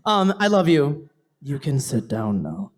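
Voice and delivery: ordinary talk, nothing marked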